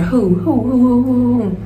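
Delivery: hooting who who like owls; owl hoots. Retold or imitated. Friends in the car hooting like owls. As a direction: hoot like a car full of owls. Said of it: "sounding like owls".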